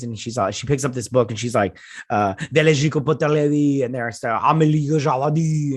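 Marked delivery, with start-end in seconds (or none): 2.5-3.8 higher, made-up French recitation; mock French. Layla reading poetry from the book, mock French accent. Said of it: "'cause they're talking French". 4.3-5.8 mock French. Reciting French poetry with Steven, mock French accent. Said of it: "'cause they're talking French".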